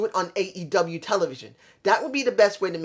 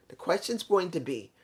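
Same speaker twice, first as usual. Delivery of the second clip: ordinary talk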